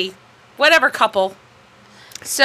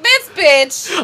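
mock disgust; 7 semitones higher, 5 dB louder than usual. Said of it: "I was like".

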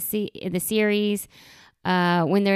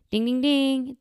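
mock bell ding; 4 semitones higher than usual. Correct answer bell.

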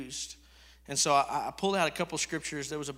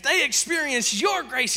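higher, louder second take of the line; emphatic repeat; 7 semitones higher, 5 dB louder than usual. Shown as a demonstration. Preacher repeating the line louder to the congregation. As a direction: hammer the repeat harder. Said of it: "repeated louder".

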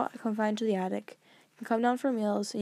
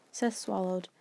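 usual speaking voice

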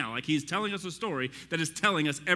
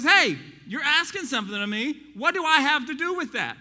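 Jesus' reply; 9 semitones higher, 6 dB louder than usual. Acted. Preacher voicing Jesus' reply.

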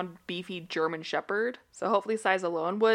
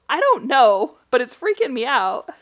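loud, higher, freaked-out protest; freaked-out cry; 4 semitones higher, 9 dB louder than usual. Retold or imitated. Answering her friend, loud and freaked out. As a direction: blurt it out, spooked. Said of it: "And I was like".

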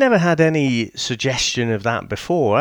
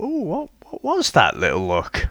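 intrigued surprise; 6 semitones higher than usual. Retold or imitated. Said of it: "and I thought".

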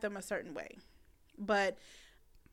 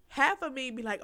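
ordinary talk

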